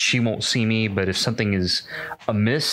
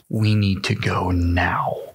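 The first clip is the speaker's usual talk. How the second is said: usual speaking voice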